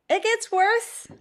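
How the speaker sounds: high and slow, a whimpering lament. Real, not acted. Grimacing after the second sip.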